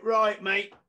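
loud, high mock challenge; confrontational. Club owner back with two heavies, confrontational. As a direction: square up to the doorman noisily.

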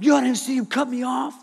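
high, restrained mock whine; high protesting voice. Speaker defending himself to upset passengers, high protest.

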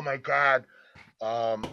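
ordinary talk, nothing marked

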